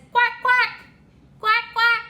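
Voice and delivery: high, short duck quacks. Pretend. Teacher recapping the duck's sound for a toddler, high short quacks. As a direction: quack high in quick pairs. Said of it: "Duck says".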